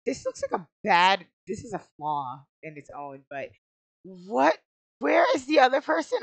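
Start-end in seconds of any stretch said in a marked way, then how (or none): 5.0-6.2 high, loud, rising question; frustrated demand. Real, not acted. Player hunting for the other person, frustrated raised voice. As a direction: call out the question in exasperation.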